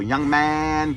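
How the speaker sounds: put-on high, loud "Young man" like the old foreman; old-school foreman barking his catchphrase; imitating the foreman. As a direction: call it out like a boss with a ruler. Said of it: "he used to go".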